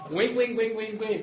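high, loud, repeated little pulses; squeaky-high comic repetition; comic demonstration. Shown as a demonstration. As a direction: chant it high and loud through laughter. Mimes the punchline amid laughter.

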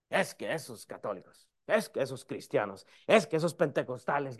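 soft, low mock grumbling; murmuración criticona. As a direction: imitate judgmental churchgoers under your breath. Mimics grumbling church people judging others. (murmullo burlón de criticones)

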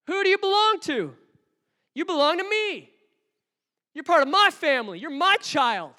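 very high, loud, fast booming challenge; God thundering at a fearful Moses; God's reply. Acted. God answering a doubting Moses, booming high and loud. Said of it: "that's God's reply to Moses".

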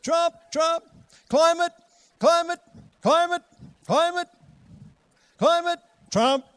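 high, loud yapping chant; news barking like dogs. Retold or imitated. Preacher mocking the news as barking dogs, high loud yaps. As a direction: bark the headlines like a neighbourhood dog. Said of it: "It's like dogs barking in the neighborhood".

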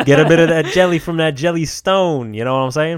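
a hammy New Jersey mobster pitching a job to Yogi; Jersey wiseguy asking Yogi to rough some guys up; mobster Jersey accent. Pretend. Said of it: "a different celebrity impression".

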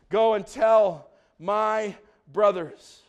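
"Go and tell my brothers" spaced out slowly; slow, deliberate reading. Real, not acted. Preacher reading Jesus' command, "Go and tell my brothers" slowly word by word. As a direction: pause before "my" and before "brothers". Verse read slowly, then repeated.

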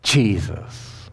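a hushed, fading mock death cry; dying cry. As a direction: let the name fall away quietly, like a last breath. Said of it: "he cried out".

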